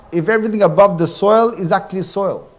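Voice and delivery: normal talking voice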